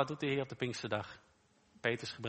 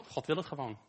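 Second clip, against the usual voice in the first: usual speaking voice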